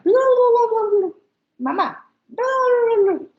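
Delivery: put-on high, loud baby babbling; baby babble. Shown as a demonstration. Speech therapist showing parents baby babble. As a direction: babble like a baby trying to talk. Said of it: "Si de repente el niño empieza".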